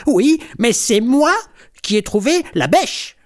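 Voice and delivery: high, loud kitten retort; squabbling, high-pitched comeback; tossed-back retort. A narrator's reading. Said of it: "lance Griffounette".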